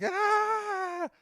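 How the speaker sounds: sudden high startled shout; startled yelp. Retold or imitated. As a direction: yelp in shock at a waving vine. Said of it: "and he's like".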